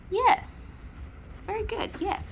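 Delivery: very high-pitched pet praise; praise for dog. Real, not acted. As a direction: coo high approval to the dog.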